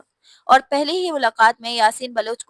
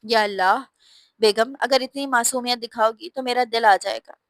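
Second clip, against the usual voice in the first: ordinary delivery, nothing marked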